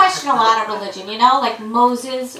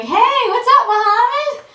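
put-on high, breezy teen Jesus; chirpy, much higher boy voice; 11 semitones higher, 3 dB louder than usual. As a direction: play Jesus as a sweet high-voiced teenager. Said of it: "Jesus is like".

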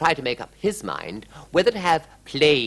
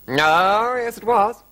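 queen voice; 11 semitones higher, 6 dB louder, slower than usual. Acted. Queen's voice in story.

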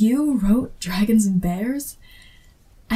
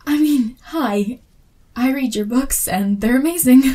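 excited; 4 semitones higher, 6 dB louder than usual. Acted. Fan gushing at favourite author.

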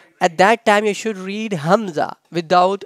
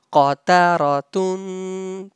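Qataratun as one connected word, last syllable held; said together. Shown as a demonstration. Teacher showing students the syllables joined, said together. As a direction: join Qataratun up, lengthening the tun. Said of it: "When you say together".

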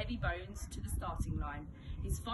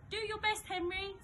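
a lightly voiced mum, higher; 11 semitones higher than usual. A narrator's reading. Mum encouraging Henry at the race, light higher voice. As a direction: encourage him gently as his mother. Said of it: "said Mum".

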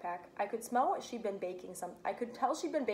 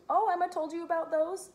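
amused surprise; 9 semitones higher, 6 dB louder than usual. A narrator's reading. Mom laughs, amused.

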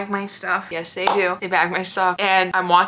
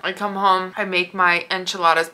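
ordinary talk, nothing marked

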